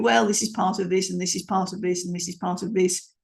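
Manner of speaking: flat monotone through each part of this; monotone list reading; all on one level. Shown as a demonstration. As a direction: keep every phrase level. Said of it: "All on the one level".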